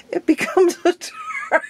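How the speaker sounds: squeaky high words dissolving into laughter; cracking up; laughing. Real, not acted. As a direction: lose it mid-quote. Quoting her, breaking into laughter.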